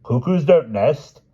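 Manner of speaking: queen reasoning with her husband; the Queen, logically. Acted. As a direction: explain it like fact to a fool. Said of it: "said the Queen logically".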